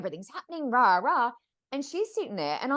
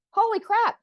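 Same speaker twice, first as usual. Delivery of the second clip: high, loud astonished cry; shocked exclamation; amazed; 12 semitones higher, 8 dB louder than usual. Retold or imitated. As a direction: she can't believe it. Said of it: "she was sitting there going".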